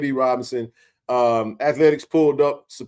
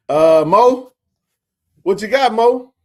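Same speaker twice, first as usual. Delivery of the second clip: louder, higher call to Mo; 8 semitones higher, 8 dB louder, slower than usual. Real, not acted. Host calling on Mo for his update, louder. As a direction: call out across the room.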